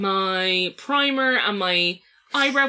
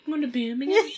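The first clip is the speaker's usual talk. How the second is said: put-on Birmingham accent, a touch higher; Brummie accent; 5 semitones higher, faster than usual. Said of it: "we can say in the Brummie accent".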